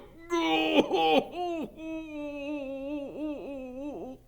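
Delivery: very high long sob; wailing sob. Acted. Hagrid bawls farewell to Norbert, long sob. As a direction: bawl it out.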